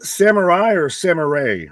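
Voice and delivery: Samurai first ending in "eye", then ending in "ray"; alternate pronunciations. Shown as a demonstration. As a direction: contrast the two endings of Samurai, high and loud. Asks which pronunciation of the name.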